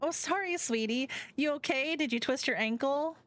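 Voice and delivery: high, sweet worried friend; high concerned best friend; fussing Finley. Acted. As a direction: coo it, pitched well up. Finley fusses over ankle.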